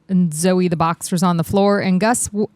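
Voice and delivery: normal talking voice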